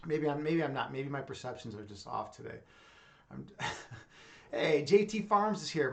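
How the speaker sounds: ordinary talk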